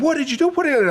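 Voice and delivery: high, frantic repeated question; wife calling after they got thrown out; alarmed. Retold or imitated. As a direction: panic at what happened. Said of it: "she said".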